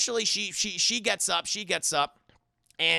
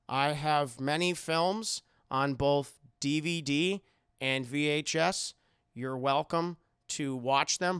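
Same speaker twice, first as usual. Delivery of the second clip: creepy captor Howard; 4 semitones lower than usual. Retold or imitated. Howard giving house rules.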